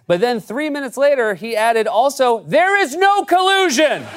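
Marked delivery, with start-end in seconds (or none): 1.9-4.1 high, slow, sheepish afterthought; mock Trump voice. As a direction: squeak it out like a guilty kid. Mocking Trump's afterthought tweet.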